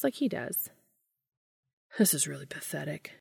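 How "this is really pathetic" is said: whispered with effort; barely manage the words, hushed; strained whisper. Acted. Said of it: "Dean manages to say".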